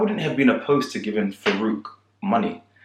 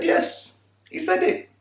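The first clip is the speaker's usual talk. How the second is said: much higher emphatic yes; high mock insistence; insisting; slower than usual. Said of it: "I said".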